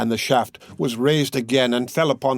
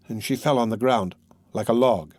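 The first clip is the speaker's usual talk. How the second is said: ordinary delivery, nothing marked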